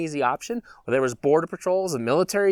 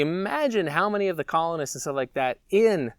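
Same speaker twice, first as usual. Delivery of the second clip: usual speaking voice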